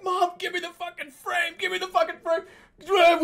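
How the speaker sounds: very high, frantic yell; acted freak-out. Son to mother as the tiger returns, hammed-up panicked yell. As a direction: shriek the demand in mock panic. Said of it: "I freak the fuck out".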